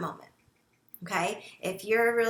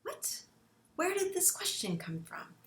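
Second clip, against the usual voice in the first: stunned, softer gasping "What?"; mock shocked parent; 9 semitones higher, 8 dB quieter than usual. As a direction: caught off guard, voice dropping away. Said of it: "And you're like".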